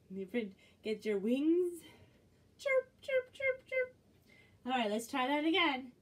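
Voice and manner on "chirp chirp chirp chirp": high squeaky chirp; chick chirp. Pretend.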